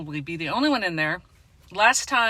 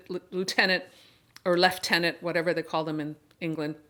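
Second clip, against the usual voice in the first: usual speaking voice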